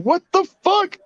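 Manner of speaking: mock shock. Retelling reaction to a tic video, high mock astonishment. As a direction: squeal the disbelief. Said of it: "I was like".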